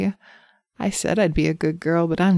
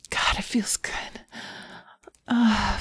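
hushed aroused whisper; barely voiced pleasure; breathy whisper; 3 semitones higher, 6 dB quieter than usual. Aroused roleplay.

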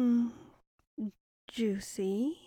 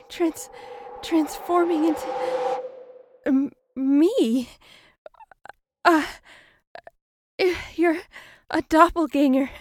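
panicked victim; 4 semitones higher, 9 dB louder, faster than usual. Acted. Victim paralyzed, facing doppelganger.

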